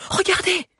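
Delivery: high sharp cry. Acted. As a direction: call out in alarm. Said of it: "cria Agnès".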